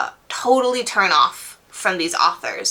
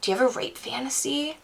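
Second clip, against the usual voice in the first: mock confiding murmur, soft and quick, a little raised in pitch; retold whisper; 4 semitones higher, 8 dB quieter, faster than usual. Date leans forward to her on third date, imitated whisper. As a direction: imitate a hushed, hurried aside. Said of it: "he leans forward to whisper".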